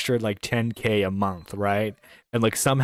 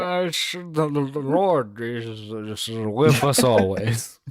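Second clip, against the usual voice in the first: put-on blackout drunk; slower than usual. Acting a drunk churchgoer speaking.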